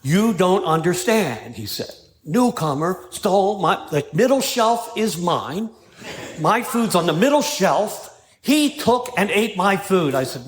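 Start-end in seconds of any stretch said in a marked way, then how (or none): 0.0-1.5 slow, drawn-out mock insistence; retold louder and slower. Sponsee repeating himself slowly to his deaf sponsor. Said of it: "he said it louder and slower". 2.2-8.1 slow, drawn-out mock insistence; retold louder and slower. Sponsee repeating himself slowly to his deaf sponsor. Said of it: "he said it louder and slower". 8.4-10.1 slow, spelled-out mock complaint; retold slower. Sponsee slowly repeating the stolen-food complaint. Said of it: "he said it louder and slower".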